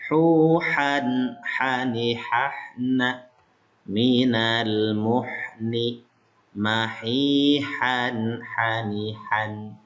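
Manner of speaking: Minal muhni drawn out very slowly, ha pronounced from the throat; slow Arabic recitation. Shown as a demonstration. (bacaan lambat dengan ha dari tenggorokan)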